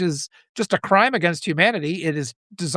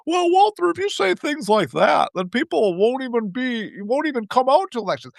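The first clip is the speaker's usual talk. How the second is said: mocking scold; 6 semitones higher than usual. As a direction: parody a concerned critic scolding Walter. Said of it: "only have energy to tell me I shouldn't be talking like this".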